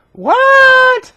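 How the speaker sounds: high-pitched drawn-out disbelief; mock astonishment, leaping way up high; incredulous reaction. David hears he will be king, high mock disbelief.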